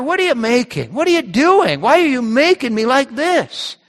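high, complaining voice of the clay; high-pitched, protesting questions; indignant clay. Retold or imitated. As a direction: whine up at the potter like an offended pot.

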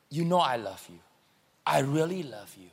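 low, gentle declaration; acted, subdued lovingness; tender affection. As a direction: say it softly to a spouse, as a model. Acted declaration of love to spouse.

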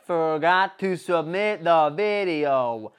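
slow, loud, stretched-out words; slowly spelling out the blunder; drawn-out exasperation. Retold or imitated. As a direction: drag every word out in disbelief. Repeats the punchline for emphasis.

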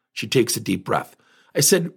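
ordinary delivery

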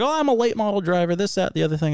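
high, dismissive imitation of an opponent; mimicked boast. Racer imitating a late model driver's boast, mocking voice.

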